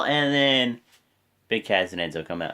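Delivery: ordinary delivery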